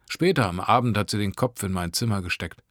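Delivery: ordinary delivery, nothing marked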